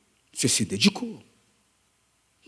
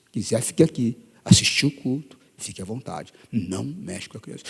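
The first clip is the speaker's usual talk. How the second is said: low, controlled threat, softly delivered; quiet stern warning; 7 semitones lower, 5 dB quieter than usual. Retold or imitated. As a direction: warn him quietly and firmly. Quiet warning to the man he grips. (aviso baixo e firme)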